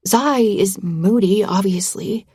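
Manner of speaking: usual speaking voice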